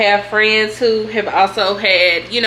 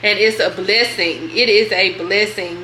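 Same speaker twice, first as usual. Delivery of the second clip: ordinary talk, nothing marked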